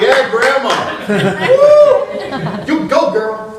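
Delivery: high, whooping cheer; hammed-up cheering. Amazed grandson cheers on grandma, hammed-up cheering. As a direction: cheer her on, pitched high with a loud whoop.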